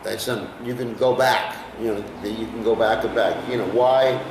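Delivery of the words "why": normal talking voice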